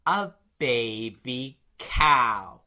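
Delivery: very slow, low, word by word; imitating a brother milking the reveal; drawn-out reveal. Brother tells eater what the meal is, drawn out. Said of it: "how long he took until he said the word cow".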